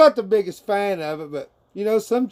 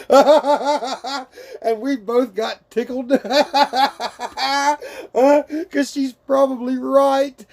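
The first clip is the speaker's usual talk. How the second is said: laughing; 7 semitones higher, slower than usual. Real, not acted. Retelling the giggling partner.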